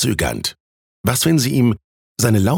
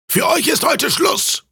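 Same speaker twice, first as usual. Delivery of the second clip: roaring innkeeper. A narrator's reading. Innkeeper throwing students out of the tavern, bellowing. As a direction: bellow it high like a fed-up landlord. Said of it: "brüllte der Wirt".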